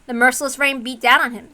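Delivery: ordinary delivery, nothing marked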